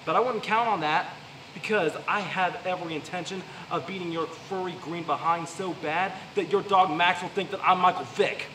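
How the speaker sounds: usual speaking voice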